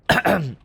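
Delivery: sharp coughing. Real, not acted. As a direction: cough to clear the throat. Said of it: "I just swallowed a fly".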